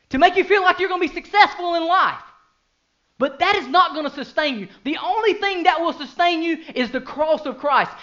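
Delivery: loud, high, impassioned preaching; full-force preaching. Acted. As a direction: preach it out to the whole congregation.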